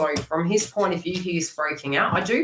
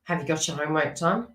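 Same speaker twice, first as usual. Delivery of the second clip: ordinary talk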